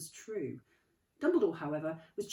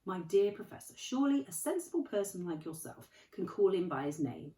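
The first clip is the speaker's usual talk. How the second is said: kindly Dumbledore; 3 semitones higher, 8 dB louder than usual. Acted. Dumbledore voiced, gently chiding.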